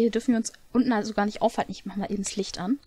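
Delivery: usual speaking voice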